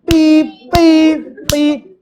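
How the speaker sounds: loud, high honk imitation; mock horn blasts; car horn beeps. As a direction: beep like impatient drivers. Cars honking behind.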